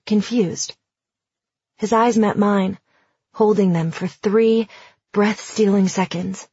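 ordinary delivery, nothing marked

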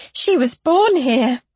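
slow, distressed outburst; a cry of realisation; anguished cry. A narrator's reading. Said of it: "Luena cried".

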